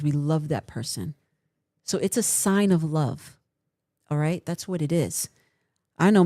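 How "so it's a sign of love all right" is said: ordinary talk, nothing marked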